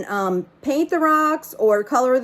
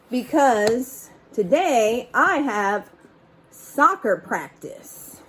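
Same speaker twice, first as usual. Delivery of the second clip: slow punchline; slower than usual. Acted. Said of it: "punchline, boy speaking".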